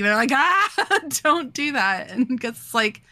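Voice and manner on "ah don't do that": high-pitched pretend panic with a laugh; playful high yelp of alarm; mock scream. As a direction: imitate a panicked shriek, half-laughing. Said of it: "like, screaming".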